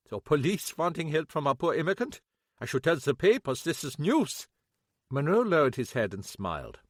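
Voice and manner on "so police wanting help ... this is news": higher, mocking accented voice; sardonic German shopkeeper. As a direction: scoff at the police asking for help. Kappelhoff sardonic.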